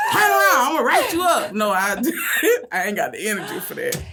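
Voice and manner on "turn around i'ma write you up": much higher, louder hammed-up bus monitor yelling; mock stern shout. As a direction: bark it like a fake strict driver, laughing at it. Mock strict bus driver threat.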